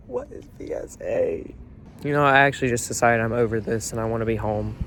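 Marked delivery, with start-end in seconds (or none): 0.1-1.6 low, whispered question; mostly whispered, voice dropped; hushed bafflement. Real, not acted. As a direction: ask it under the breath, low and soft. Same question, now hushed.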